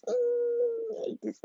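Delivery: high squeaked exasperation; squeal. Real, not acted. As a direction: voice cracks up in frustration.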